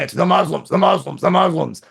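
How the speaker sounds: lightly mimicked gruff host on repeat; Alex Jones impression. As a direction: harp on it like a mock ranter. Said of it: "I'm listening to him".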